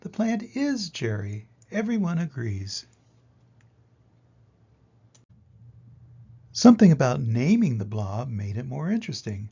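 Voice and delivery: lively read-aloud storytelling voice; story narration. A narrator's reading. Teacher reading a picture book to kids, lively storytelling.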